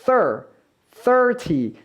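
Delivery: THIRTY with the tongue put between the teeth and pulled back on the th. Shown as a demonstration. English teacher showing Korean learners the th, careful THIRTY with tongue between teeth. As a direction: say THIRTY with a clear dental th, not an s. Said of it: "이와 이 사이에 혀를 넣었다가 빼면서".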